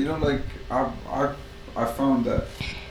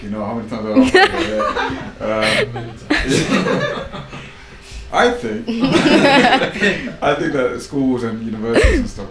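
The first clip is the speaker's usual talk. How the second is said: high giggly talk through laughter; laughing; 9 semitones higher, 5 dB louder than usual. Real, not acted. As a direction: laugh at yourself as you speak. Laughs at repeating himself.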